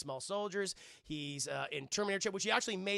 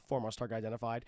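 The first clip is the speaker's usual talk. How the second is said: lightly voiced robot, much lower; 8 semitones lower, much faster than usual. Streamer voices Protector One on patrol, low robot. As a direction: drop low for a hint of machine. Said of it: "good old Protector One".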